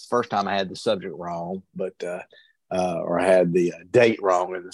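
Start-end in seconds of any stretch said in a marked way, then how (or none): none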